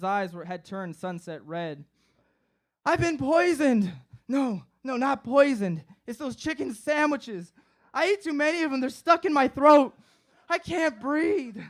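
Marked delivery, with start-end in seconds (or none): 2.8-11.6 high-pitched, frantic pleading; hysterical panic. Retold or imitated. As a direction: sound like you truly think you're dying. Panicking, thinks he is choking.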